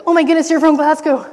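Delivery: loud, high, fast outburst; mock fangirl squeal at a stranger; acted gushing excitement. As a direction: blurt it out, overjoyed and unrestrained. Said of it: "tried not to be like".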